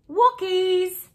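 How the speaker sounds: high excited shout. Acted. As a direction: call out happily to the dogs. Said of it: "Mom shouted".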